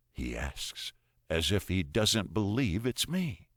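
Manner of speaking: ordinary delivery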